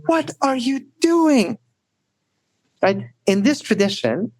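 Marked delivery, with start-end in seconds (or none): 0.1-1.6 much higher, slow, alarmed outburst; frightened, angry cry. Retold or imitated. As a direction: cry it out, shocked and upset at the loss. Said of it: "frightened, angry, upset".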